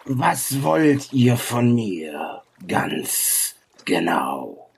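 partly whispered, stretched words; ancient creature sage. Acted. Sage questions the travellers, slow and deliberate. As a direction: draw it out slowly, falling low at the end. Game master voices the sage.

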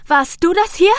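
high, loud frightened demand; scared accusing cry to her brother; panicked question. A narrator's reading. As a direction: fire the question in fear. Panicked girl confronting her brother.

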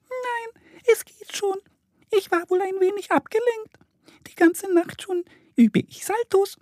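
squeaky, extremely high bat, a little dazed; Flina. A narrator's reading. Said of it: "eine piepsige Stimme". (piepsige Fledermausstimme)

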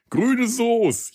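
high delighted announcement; excited. Real, not acted. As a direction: proudly present the dish. Announces his first good Frankfurt experience.

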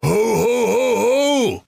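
very high hammed-up ho ho; Santa ho ho. Santa laughing off oversleeping, high hammy ho ho. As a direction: belt out a silly high ho ho. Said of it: "sprach der Weihnachtsmann".

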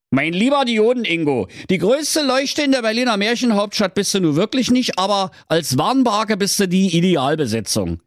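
high, pompous royal decree voice; pompous king. Acted. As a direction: proclaim it like a silly king. Baustellenkönig Mirko Mörtel spricht. (hoher, feierlicher Königston)

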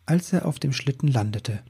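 plain reading voice

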